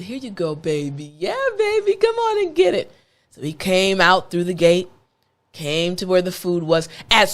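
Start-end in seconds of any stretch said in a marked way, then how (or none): 0.0-2.9 coaxing. Retold or imitated. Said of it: "I said".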